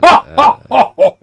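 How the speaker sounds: high, loud evil cackle; maniacal laugh. Acted. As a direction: cackle with unhinged menace. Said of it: "That's my best maniacal laugh".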